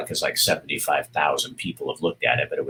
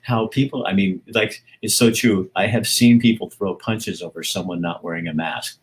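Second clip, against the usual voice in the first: ordinary talk, nothing marked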